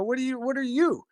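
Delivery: high mock outrage; teasingly high-pitched comeback; mock protest. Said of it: "I go".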